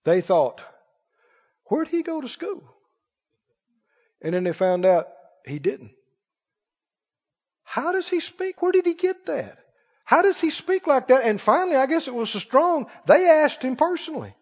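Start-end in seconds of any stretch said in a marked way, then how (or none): 1.7-2.6 soft, high-pitched wondering question; wondering scholar. Acted. Acting out impressed scholars' wondering. 7.6-11.2 high-pitched, marvelling questions; amazed questioning. Acted. Acting out impressed scholars' questions.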